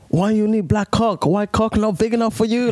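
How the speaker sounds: put-on high-pitched screaming Malaysian mum accent. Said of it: "I will do the accent".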